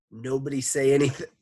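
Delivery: fast, loud hurried warning; urgent warning. Acted. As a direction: hurry to warn the class. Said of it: "Jenny tried to warn".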